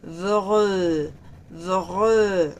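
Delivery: vre as bare V R sounds, no vowel; V R cluster demo; consonants only. Shown as a demonstration. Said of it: "solo los sonidos de las consonantes V R".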